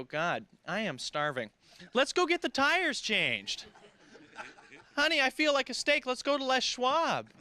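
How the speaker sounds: slow, high, mock-dreamy customer voice; drawn-out daydreaming tire shopper; mock hungry customer. Acted customer quote in stand-up.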